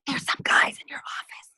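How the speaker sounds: imitating a secretary's whisper; urgent hushed whisper, no voice. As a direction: whisper it like confidential news. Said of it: "Why are you whispering?".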